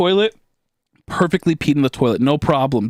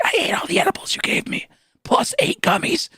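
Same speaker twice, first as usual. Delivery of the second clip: a tiny, high whispered admission; meek whisper; 3 dB quieter than usual. Retold or imitated. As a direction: confess under your breath, afraid of the reaction. Admitting to mom meekly.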